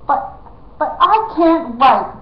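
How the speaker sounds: high, loud, slow blurt. A narrator's reading. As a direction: let it slip out. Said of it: "blurted out Prince Brat".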